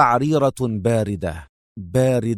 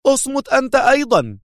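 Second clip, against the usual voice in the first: much higher, louder yell; nervous shout; 13 semitones higher, 3 dB louder than usual. A narrator's reading. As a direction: snap at him too. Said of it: "صاحت به لوتشيا في عصبية".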